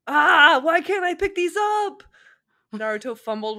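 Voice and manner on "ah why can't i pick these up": high loud exasperated wail; frustrated whine. Acted. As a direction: whine at the useless chopsticks. Naruto fumbles chopsticks.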